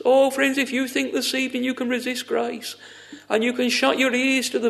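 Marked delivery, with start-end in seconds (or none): none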